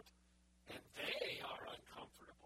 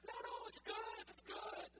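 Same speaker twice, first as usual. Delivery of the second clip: very high, bright cheerful reassurance from God; God reassuring; 10 semitones higher, slower than usual. Acted. God waving off the preacher's anger, high and happy. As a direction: brush the worry off lightly, pitched way up.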